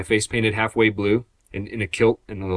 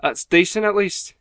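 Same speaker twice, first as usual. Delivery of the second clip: higher, put-on Scottish lilt; Scottish accent; 7 semitones higher than usual. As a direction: answer in a mock Scottish accent. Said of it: "where exactly in Scotland you're meant to be from".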